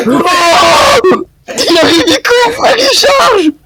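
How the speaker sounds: high panicked shout; being chased. Real, not acted. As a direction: yell in panic as it charges. Zombie charging at them.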